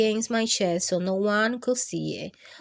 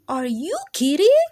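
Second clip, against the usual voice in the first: put-on louder, incredulous kid voice; surprised disbelief; 5 semitones higher, 9 dB louder than usual. Daisy to Ralph, loud surprised disbelief. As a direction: throw it back at him in disbelief.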